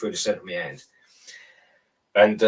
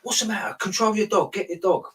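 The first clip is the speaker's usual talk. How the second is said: much higher voiced bystanders yelling; crowd shouting; 10 semitones higher than usual. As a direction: play the angry crowd telling him off. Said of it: "people were shouting at him".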